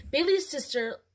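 plain reading voice

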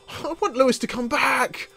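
high, pleading whine; mock whining. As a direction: whine like you miss him. Whining that Lewis is gone.

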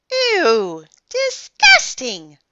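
high, loud, drawn-out disgust; grossed out. Acted. Boy grossed out by kiss.

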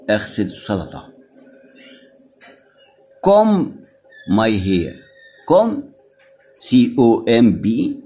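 ordinary delivery

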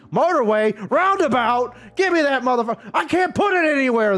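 high, loud exasperated outburst; frustrated rant. Real, not acted. Gamer fuming that a roundabout won't fit, frustrated rant. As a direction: complain at the game with rising pitch.

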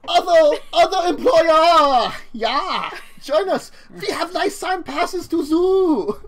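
loud, high German thug voice, slow; German accent. Acted. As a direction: bellow it in a thick German accent. Thugs greet players in German accent.

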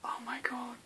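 barely audible, overwhelmed whisper; hushed, voiceless amazement; awed whisper. Real, not acted. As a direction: whisper it under your breath in awe. Overwhelmed on smelling the gift.

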